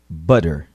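Butter with a quick tapped D in the middle; flapped T. Shown as a demonstration. Teacher models Butter for repetition, flapped T. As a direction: say Butter like budder, light flap. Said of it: "Ouça e repita".

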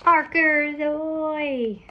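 long, high call held out slowly; drawn-out call. Pretend. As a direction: stretch it out like a sailor's hail. Drawn-out call opening the story.

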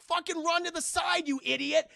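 loud high yell; shouting. Retold or imitated. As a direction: yell at him to move. Soldier yelling in combat.

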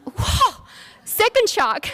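high startled second whoa; stunned all over again, voice jumping up; high disbelieving cry; shocked whoa. Retold or imitated.

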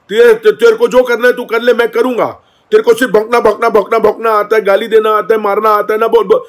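high, loud defiant yell; mock angry shouting. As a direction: imitate a hyper guy shouting back. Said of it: "जिस लहजे में, जिस level में, जिस tone में बात हुई". (नकली गुस्से में चिल्लाना)

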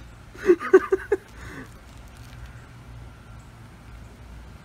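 breathy, high laughing fit; high wheezing laugh. Real, not acted. Laughing over whose pepper was hotter, high wheezy laughter. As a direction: laugh helplessly, trailing into breath.

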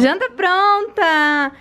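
slow, high and loud; drawn-out happy call. Real, not acted. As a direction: call it out, stretching pronta.

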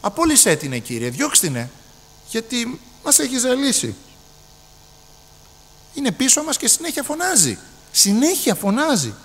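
high, whiny complaint to the Lord; exasperated disciples. Retold or imitated. Disciples begging Jesus to send her away, exasperated and high. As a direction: sound fed up with her shouting. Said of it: "οι ίδιοι μαθητές".

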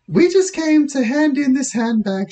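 high, put-on girlish voice; girl's voice. Lisa explaining to police, high girlish voice. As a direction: pitch it up as a young girl.

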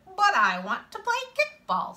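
put-on high-pitched protest from a kid; insistent child. A narrator's reading. Boy protesting to kids who sent him away, high and insistent. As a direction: push back in a high kid's voice.

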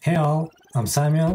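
usual speaking voice

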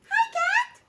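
very high, squeaky mouse voice. Acted. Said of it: "squeaked Mouse".

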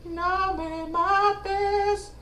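high, slow terrified plea; terrified horror victim. Acted. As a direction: beg for your life in a high wail. Pleading in put-on voice.

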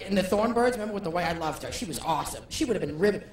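ordinary talk, nothing marked

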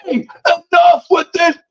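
high, drawn-out mock scream; slow, strained retold yell; imitated screaming. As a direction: imitate an exasperated man screaming, words stretched. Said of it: "he starts screaming".

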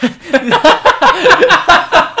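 helpless, high laughter through the words; real giggling apology; laughing fit. Real, not acted. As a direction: apologise while cracking up.

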